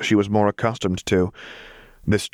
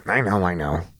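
plain reading voice

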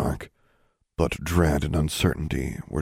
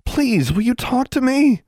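high, loud pleading call; 6 dB louder than usual. A narrator's reading. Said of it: "Alexandra shouted".

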